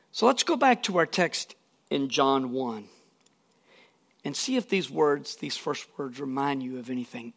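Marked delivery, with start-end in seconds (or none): none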